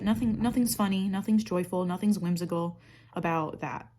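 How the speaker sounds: ordinary delivery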